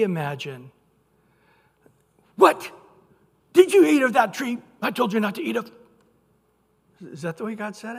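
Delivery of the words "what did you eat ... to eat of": loud, fast, accusing shout; condemning shout. Shown as a demonstration. Pastor showing a condemning God, loud and fast. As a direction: bark it out like an angry judge. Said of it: "This is where writing is so insufficient".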